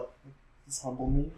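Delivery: soft, puzzled inner voice; quietly wondering to himself; puzzled muttering. Retold or imitated. Voicing Trump's inner thought.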